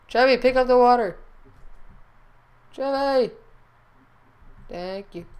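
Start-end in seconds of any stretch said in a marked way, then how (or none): 0.1-3.3 calling out. Real, not acted. Calling the pet.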